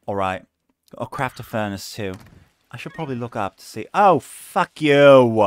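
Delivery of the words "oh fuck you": drawn-out loud curse at the game; slow, loud, mock-outraged curse; cursing at the game. Real, not acted.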